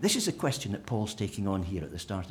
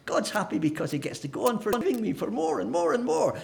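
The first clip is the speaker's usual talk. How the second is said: higher, louder, mock-delighted reasoning; mock enthusiasm; 6 semitones higher, 4 dB louder than usual. Preacher parodying sin-for-grace thinking, mock glee. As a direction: sell the absurd logic gleefully. Mocking the sin-more logic.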